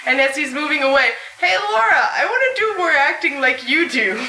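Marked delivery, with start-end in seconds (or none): none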